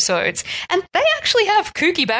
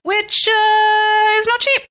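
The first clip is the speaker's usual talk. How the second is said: pitched way up, comic stress on cheap; high emphatic squeak; 12 semitones higher, 5 dB louder, much slower than usual. Real, not acted. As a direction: squeal it as a wry joke. Joke about travel costs.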